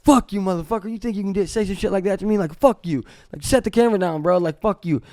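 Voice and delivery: high-pitched, heated retold tirade; pissed-off drunk rant. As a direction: act out a drunk man going off. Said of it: "So it pissed me off".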